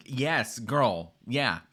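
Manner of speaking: ordinary talk, nothing marked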